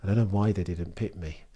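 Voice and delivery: usual speaking voice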